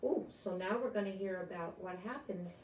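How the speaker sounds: usual speaking voice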